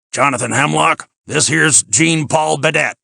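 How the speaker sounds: folksy host booming out introductions; plain-spoken Ben. A narrator's reading. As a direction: anglicise the foreign names, loud and unbothered. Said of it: "nothing to do with these phony foreign pronunciations".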